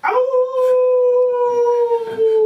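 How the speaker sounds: big high burst of laughter; loud laugh. Real, not acted. Laughing at a joke.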